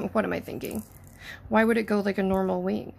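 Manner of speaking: usual speaking voice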